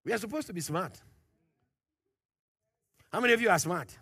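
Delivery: normal talking voice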